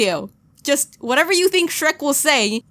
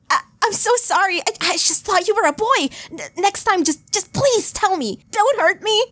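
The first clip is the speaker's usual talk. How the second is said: high, stammering mock fright; shaking in fear; 8 semitones higher than usual. Boy Kisser apologizing to the user, acted stammering fear. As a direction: plead nervously in a high voice. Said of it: "shaking in fear".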